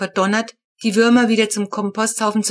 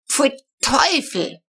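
drawn-out, higher yuck; disgusted cry; 6 semitones higher, slower than usual. Retold or imitated. Narrator recoiling from a box in the fridge, disgusted.

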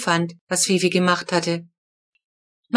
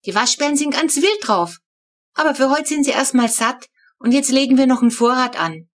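a hint of a small girl, pitched higher; lightly voiced child explaining; 5 semitones higher than usual. Flo explaining the worm digging to her sibling, lightly childish. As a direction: explain it like a keen little kid.

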